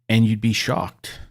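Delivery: normal talking voice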